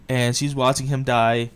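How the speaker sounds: ordinary talk, nothing marked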